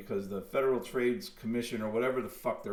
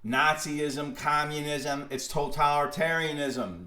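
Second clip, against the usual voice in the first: ordinary talk, nothing marked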